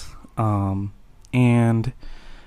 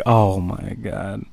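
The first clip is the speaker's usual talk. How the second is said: slow, low, hushed mortification; guilty, embarrassed; 4 semitones lower, 6 dB quieter, slower than usual. Retold or imitated. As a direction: imitate her sinking with embarrassment, softly. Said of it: "she looked so guilty and embarrassed".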